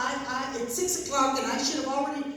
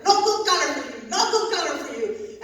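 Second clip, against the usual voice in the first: louder, higher mock scold; laughing imitation of the lady refusing the color; mock grouchy manicurist; 4 semitones higher, 5 dB louder than usual. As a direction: brush her off like the grouchy lady. Mimics nail lady refusing color.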